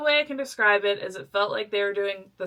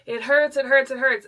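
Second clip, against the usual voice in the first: pained cry; 5 semitones higher, 5 dB louder than usual. Retold or imitated. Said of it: "I'm screaming".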